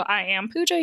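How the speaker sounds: ordinary talk, nothing marked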